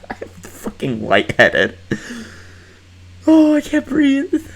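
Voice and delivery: high, loud flustered squeal; giddy. Real, not acted. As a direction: swoon out loud. Overwhelmed by the gorgeous killers.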